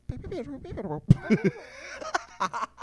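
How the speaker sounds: high, fast beeping babble; imitated R2 droid noises. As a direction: chirp and beep like a little droid. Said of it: "You're like".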